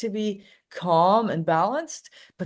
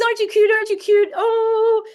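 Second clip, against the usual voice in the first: put-on very high, louder gushing baby talk; cooing baby talk; 7 dB louder than usual. The partygoers fussing over the little dog, gushing high baby talk. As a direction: gush over the dog as the whole crowd. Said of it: "everybody comes up and says".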